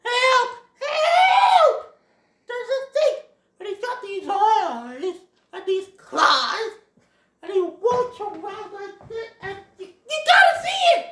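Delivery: hammed-up panic, very high shouting; Thumper. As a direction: cry for help in exaggerated terror. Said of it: "he's all like".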